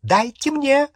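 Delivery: high-pitched, urgent cry; frantic high command; shrill demand. A narrator's reading. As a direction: shriek for it to be handed over. Bellatrix demands the sword.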